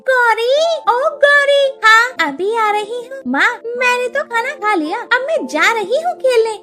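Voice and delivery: put-on high excited girls calling out; child voices. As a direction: call like playful village kids. (बच्चों की ऊँची चहकती आवाज़)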